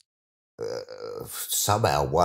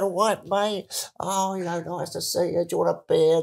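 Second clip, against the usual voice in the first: friendly fan; 12 semitones higher than usual. Retold or imitated. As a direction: greet him like a mate at the bar.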